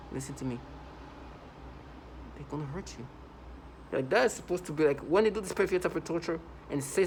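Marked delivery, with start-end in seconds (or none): 0.1-3.1 lightly faked gentle warning; mock soothing voice. Mocking a fake caring warning to the victim. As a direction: mimic a fake caring tone, barely changed. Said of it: "trying to sound like this".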